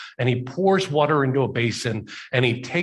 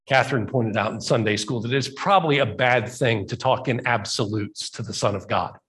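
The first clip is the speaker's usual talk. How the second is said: ordinary talk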